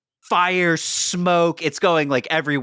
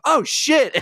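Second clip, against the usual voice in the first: much higher, startled oh; mock alarm; 13 semitones higher than usual. Wrestlers hearing the alarm, in mock alarm. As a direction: gasp it out in fake shock. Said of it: "these guys be like".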